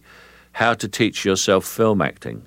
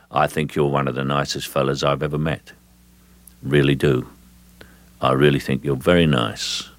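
lower, emotionless gangster menace; icy Cockney gangster; 4 semitones lower than usual. Acted. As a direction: compliment them with no emotion whatsoever. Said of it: "It's like an icicle".

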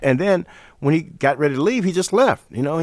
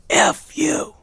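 gruff, slow whispered rasp; imitated gruff, voiceless growl of a put-down; 5 semitones higher, much slower than usual. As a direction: rasp it out in a hoarse whisper, dismissive. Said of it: "Miles said in that gruff voice".

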